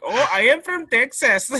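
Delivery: put-on a quick Indian accent. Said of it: "I almost did an Indian accent".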